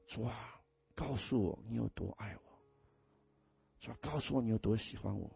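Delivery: soft intimate prayer. Retold or imitated.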